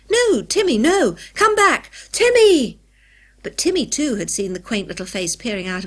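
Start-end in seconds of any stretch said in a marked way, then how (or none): 0.1-2.8 loud, high shouts after a dog; urgent yelled commands; calling out urgently. A narrator's reading. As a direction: shout the dog back in alarm. Calling back a runaway dog.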